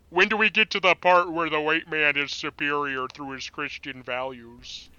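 gruff monster voice mocking him; Cookie Monster voice. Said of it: "sounded like the Cookie Monster there".

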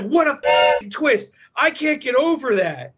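high, drawn-out amazement; astonished outburst. Retold or imitated. Moviegoer who can't get over the twist, high astonished wail. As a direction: wail it in overwhelmed disbelief.

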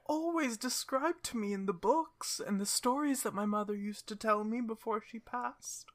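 high, gentle voice of a sad cursed princess; sad cursed princess bear. Acted. Bear-princess tells the party her sad story, in a high gentle voice. Cinderella continues.

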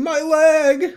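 high, loud mock scream of agony; imitated scream. Podcaster mimics an injured extra in the background, screaming. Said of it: "a guy in the background scream".

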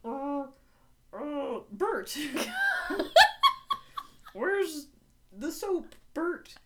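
high-pitched, silly puppet voice; Muppet voice. Pretend. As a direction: call out like a goofy Muppet. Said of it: "don't not sound like a Muppet".

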